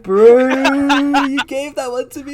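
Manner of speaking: high, gleeful taunt; excited teasing. Real, not acted. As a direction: gloat at your friend. Teasing after winning.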